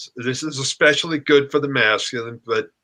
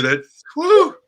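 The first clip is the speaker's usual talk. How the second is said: swooning whew; 4 dB louder than usual. Real, not acted. Swooning after hearing words.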